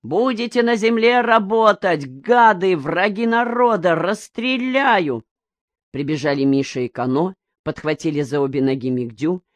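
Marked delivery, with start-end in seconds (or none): 0.0-5.2 put-on drunken shouting. A narrator's reading. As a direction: bellow threats so the whole village hears. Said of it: "Мигдя кричал на всё село".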